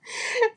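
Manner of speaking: high-pitched giggle; real laugh. Real, not acted. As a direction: let a high giggle slip out. Amused by her own story.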